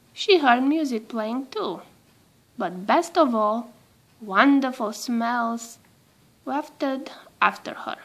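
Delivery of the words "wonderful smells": ordinary delivery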